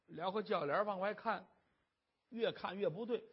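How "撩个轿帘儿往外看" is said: plain reading voice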